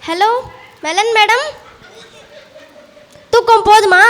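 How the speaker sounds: loud scolding question; loud classroom reprimand; teacher's scolding. Acted. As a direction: sharply ask the dozing pupil. Teacher scolds sleeping pupil.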